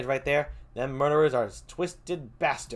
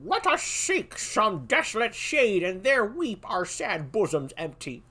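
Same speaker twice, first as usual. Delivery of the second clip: Malcolm voice; 8 semitones higher than usual. Acted. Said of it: "I'll do like a".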